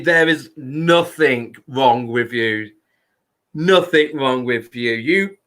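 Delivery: usual speaking voice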